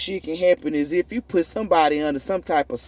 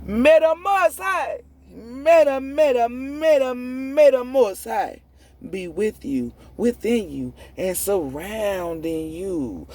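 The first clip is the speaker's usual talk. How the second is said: blessing intoned; 6 semitones higher, slower than usual. Real, not acted. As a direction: call out the blessing like a chant. Closing blessing, sung-out.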